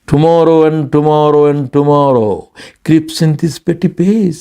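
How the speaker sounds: very slow, drawn-out Shakespeare recital; dramatic recitation. Acted. Preacher quoting Macbeth to his congregation, slow and theatrical. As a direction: savour each word like a stage soliloquy.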